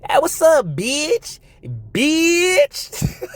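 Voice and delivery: high, hammed-up woman's greeting; mock woman voice. Streamer imitating women greeting each other, high mock voice. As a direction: squeal it like a mocking impression.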